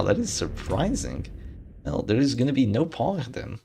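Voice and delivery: normal talking voice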